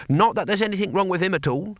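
put-on high-pitched, defensive old warrior; defiant. A narrator's reading. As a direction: insist defiantly, pitch well up. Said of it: "he said defiantly".

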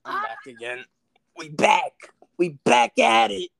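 hyped-up high greeting; excited. Real, not acted. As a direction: announce the return with energy. Returning to stream, hyped.

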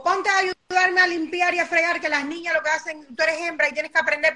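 high, fast bossy order; mother ordering her daughter to do the chores. Retold or imitated. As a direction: order the girl about like a strict mum.

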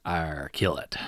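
low pirate growl with an Arr; pirate captain. A narrator's reading. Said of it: "the pirate says".

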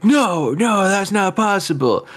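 hammed-up denial; imitated disbelief. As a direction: play the stunned guy. Retold scene, imitated character.

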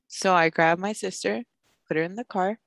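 usual speaking voice

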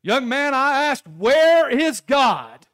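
high, loud, slow shout; pastor yelling. Retold or imitated. Pastor shouting into the boy's face, high and loud. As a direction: bellow the question right in his face. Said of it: "looks him straight in the eyes and says".

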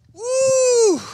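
long high-pitched "woo"; high whoop. Real, not acted. Preacher after driving home his point, high excited whoop. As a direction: let out a sustained high whoop.

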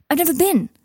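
high, eager admission; excited cry. A narrator's reading. Boy telling Teddy about the joke shop, high and eager. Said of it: "cried Scorpius".

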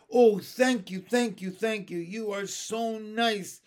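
high, loud burst of thanks, easing in volume; grateful cry. A narrator's reading. Benny thanks the lady for the dog, crying out gratefully. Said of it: "cried Benny".

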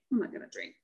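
whispered under the breath to herself; a voiceless, self-convincing whisper; whispered mantra. Retold or imitated. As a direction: whisper the promise so nobody hears. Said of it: "I said".

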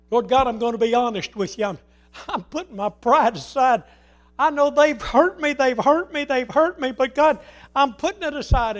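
fervent loud prayer, the words repeated like a wound; impassioned prayer. Acted. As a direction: cry out to God over the hurt. Voices an anguished prayer.

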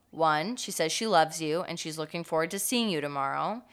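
ordinary talk, nothing marked

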